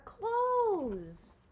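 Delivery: clothes hit high and loud; stress on clothes. A narrator's reading. Story's moral, clothes stressed high and loud. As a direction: punch the word clothes. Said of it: "emphatic punchline".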